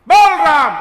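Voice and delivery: "बोल Ram" shouted high and loud, with force on "बोल"; angry shout. Acted. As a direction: bark the order at the son, full volume. Guru shouts at the stubborn son. (गुरुजी का बेटे पर ज़ोर से चिल्लाकर आदेश)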